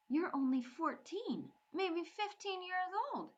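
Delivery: high, rising disbelief at his youth; incredulous. Acted. Bree doubting the young Viking leader, incredulous. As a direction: say it as if you can't believe it.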